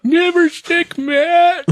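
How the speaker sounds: squeaky, very high old man imitation; old man Duffy. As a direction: screech it up high, mock old-timer. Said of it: "That was old man Duffy".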